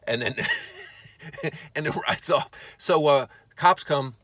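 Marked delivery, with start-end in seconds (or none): 0.4-1.7 very high imitated taunting laugh; put-on high cackle; mocking laugh. Thief taunting his pursuer before riding off, high mocking laugh. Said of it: "he stops to mock me".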